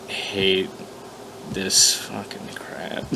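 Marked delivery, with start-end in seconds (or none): none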